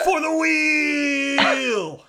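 long, high, rallying shout; drawn-out charge yell; battle cry. Acted. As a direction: cry it out, stretching the last word.

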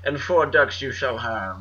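rich guy voice. Acted. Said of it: "It's my rich guy voice".

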